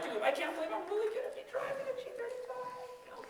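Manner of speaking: high soft giddy squeal. Retold or imitated. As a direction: squeal it high and small, dreaming aloud. Giddy daydream in the car.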